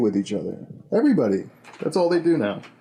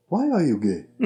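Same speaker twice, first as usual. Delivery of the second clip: lightly put-on African accent; a hint of an African accent, asking; 6 semitones higher than usual. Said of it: "my African guy voice".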